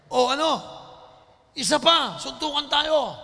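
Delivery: loud, high furious dare; angry eleven-year-old daring a rematch, shouting; angry challenge. Retold or imitated. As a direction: bark the challenge at him. Angry rival challenging again.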